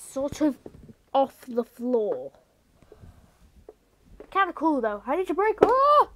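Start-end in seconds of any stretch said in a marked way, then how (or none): 5.6-6.1 sudden high, loud yelp of surprise; a real startled shout; startled cry. Real, not acted. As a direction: jump at the pedal snapping up. Pedal slips, sudden cry.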